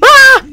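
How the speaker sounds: sudden high loud yelp of fright; startled yelp. Real, not acted. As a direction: jump at the attack with a sharp cry. Startled by a zombie.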